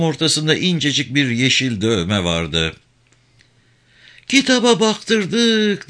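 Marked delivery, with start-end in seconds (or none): 4.3-5.9 put-on high, slow wail of an old mother; crying. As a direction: drag the words out through tears. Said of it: "diye ağladı".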